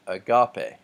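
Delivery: "agape" slowly with GA punched, a-GA-pe; stress on second syllable. Shown as a demonstration. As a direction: hit the middle of "agape". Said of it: "is over the second alpha".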